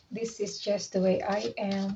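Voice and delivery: soft, slow "This is just the way I am"; tender confession. Retold or imitated. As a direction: say it softly and slowly to your partner. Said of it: "Kamu ngomong gitu sama dia". (pengakuan lembut dan pelan)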